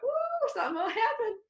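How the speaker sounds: playful spooky warning; eerie foreboding. Retold or imitated. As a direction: tease the coming ghost. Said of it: "and I went".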